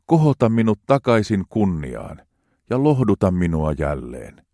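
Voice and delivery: hartaasti, rauhallisesti luettu psalmi; juhlallinen psalmin luenta. Acted. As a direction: lue kuin rukousta jumalanpalveluksessa. Reads psalm aloud solemnly. (juhlallinen raamatunluku)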